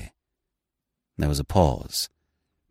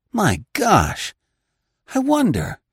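high, sudden exclaim; cried out; 14 semitones higher, slower than usual. Acted. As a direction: cry it out in alarm. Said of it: "he cried".

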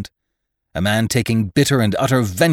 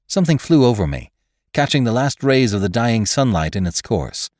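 ordinary delivery

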